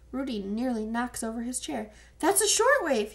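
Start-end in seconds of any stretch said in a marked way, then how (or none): 2.2-3.1 much higher and louder alarmed cry; frightened cry. A narrator's reading. Rudy, panicked at hearing the BBC, crying out. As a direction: blurt it out in sudden panic. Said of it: "he cries".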